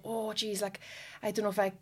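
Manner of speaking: soft, weary mock reluctance; imitated reluctant partner. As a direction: voice the hesitant partner quietly.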